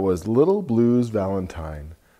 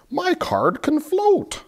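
high duck voice. Acted. Duck speaks in picture book.

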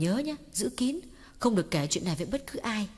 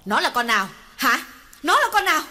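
much louder, higher bitter questioning; bitter demanding; 8 semitones higher, 8 dB louder than usual. Acted. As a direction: demand the name of the other woman. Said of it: "Cô cay đắng hỏi". (cay đắng tra hỏi)

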